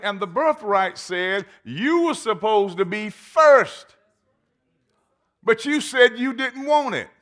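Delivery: high, loud, laughing-scornful father; Isaac rebuking. Acted. Isaac telling Esau there's no double blessing, scornful. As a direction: scold the son who sold his birthright.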